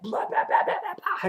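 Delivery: rapid high-pitched slashing babble; mimed slashing noise. Retold or imitated. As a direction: make frantic hacking noises. Imitating the slicing of a neck.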